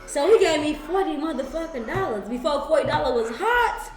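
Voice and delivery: ordinary delivery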